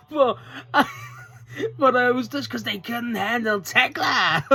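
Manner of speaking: high, drawn-out mock boast; Tekla. Fan mimics wrestler bragging about Japan, high crowing impression. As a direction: crow it slowly in a hammed-up promo voice. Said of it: "and then Tekla goes".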